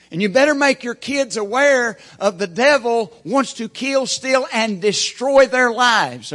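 loud, high preaching with hard stresses; full-force preaching. Acted. Pastor warning parents about the devil, loud preaching. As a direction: hammer it home to the congregation.

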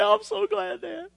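high, cracking voice of relief through tears; tearful relief. Retold or imitated. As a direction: let the gratitude break the voice. Son relieved, tearful, to father.

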